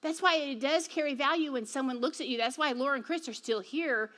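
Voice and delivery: ordinary talk, nothing marked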